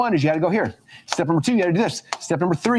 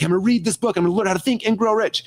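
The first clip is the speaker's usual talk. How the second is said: eager; 6 semitones higher than usual. Retold or imitated. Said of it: "I'm like".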